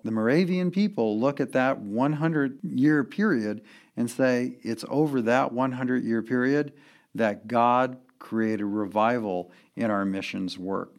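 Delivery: usual speaking voice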